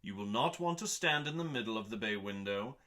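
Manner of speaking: ordinary talk, nothing marked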